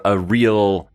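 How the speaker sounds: ordinary talk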